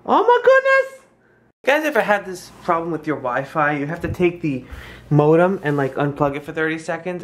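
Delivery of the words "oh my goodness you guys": squeaky, very high and loud. Real, not acted. Mom marvelling to her toddler in a squeaky high exclaim.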